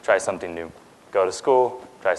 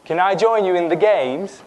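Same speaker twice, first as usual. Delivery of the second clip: high-pitched put-on British accent; British accent, raised and carefully performed; 8 semitones higher, 4 dB louder than usual. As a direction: ask in a schoolboy's British English. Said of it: "He speaks in a British accent".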